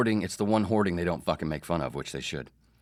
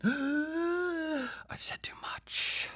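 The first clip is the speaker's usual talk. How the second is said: gasp, then a slow dramatic whisper; hammed-up hushed regret; mock-alarmed whisper; much slower than usual. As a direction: whisper it like a secret slipped out. Mock alarm at having overshared.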